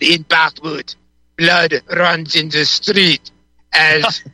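put-on slow, drawn-out Yiddish accent. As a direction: lay on a Yiddish accent, slowly. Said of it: "practicing a Yiddish accent".

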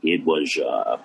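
low, slow talk-show host impression; Johnny Carson. Comedian doing a walk-on at a radio show, Carson impression. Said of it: "See if I can remember Johnny".

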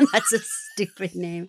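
slow repeat through laughter; drawn-out, giggling echo; amused echo. Retold or imitated. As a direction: laugh while savoring the quoted line. Laughingly repeats co-host's quoted line.

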